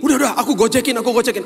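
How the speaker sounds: high, loud, rushed insistence; urgent insistence. Retold or imitated. Man insisting he will order the ride, urgent. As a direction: press the offer eagerly. Urgent offer retold in story.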